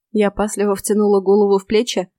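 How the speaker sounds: ordinary delivery, nothing marked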